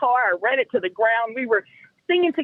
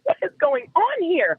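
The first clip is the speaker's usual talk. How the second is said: astonished; 8 semitones higher than usual. Retold or imitated. Retelling her astonishment, voice jumps.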